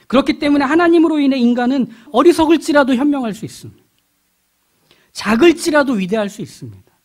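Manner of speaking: high, loud climactic proclamation; full-force preaching. Real, not acted. Preacher declaring that the weak can be great, full-force preaching. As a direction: cry it out to the congregation, each line landing.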